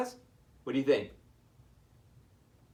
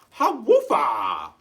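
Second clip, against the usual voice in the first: loud, slow woofing jeer; dog jeering at Shelley; mocking dog laugh; 7 dB louder, slower than usual.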